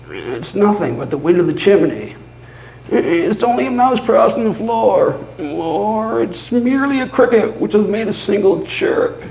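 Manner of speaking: high, fast, nervous reassurance; old man's self-comfort. Retold or imitated. Narrator voicing the old man comforting himself, high and anxious. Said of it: "He had been saying to himself".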